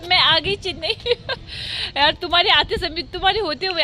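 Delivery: high, loud, laughing shout-out to absent friends; excited calling out. Real, not acted. Calls to absent friends on camera. (हँसते हुए, खुशी से दोस्तों को पुकारना)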